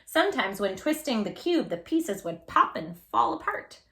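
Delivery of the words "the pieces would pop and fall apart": ordinary delivery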